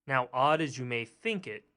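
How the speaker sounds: ordinary delivery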